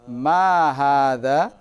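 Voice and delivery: ma hadza slow, MA stressed, rising at the end; question intonation. Shown as a demonstration. Teacher modelling the question tune of ma hadza. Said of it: "lihat intonasinya".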